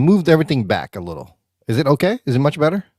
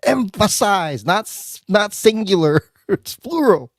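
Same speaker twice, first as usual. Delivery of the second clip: imitating wife; 12 semitones higher, much slower than usual. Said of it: "caught shit from my wife and my daughter".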